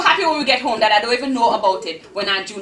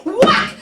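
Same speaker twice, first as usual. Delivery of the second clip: sharp loud high whack of a belt; 14 semitones higher, 7 dB louder than usual. Acted. Aunt's belt strikes the girl, loud whack. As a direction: crack it out like leather hitting skin.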